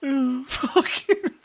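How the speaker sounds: high, slow laugh through the swear. Real, not acted. Player cracking up at the game's comeback, laughing. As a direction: break up laughing mid-word.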